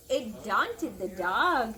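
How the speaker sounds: plain reading voice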